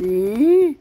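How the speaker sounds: sharp loud call to the monkeys; shout. Real, not acted. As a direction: shoo them off with a loud "ఏ". Shooing monkeys off the wall. (కోతులను తరుముతూ గట్టిగా అరుపు)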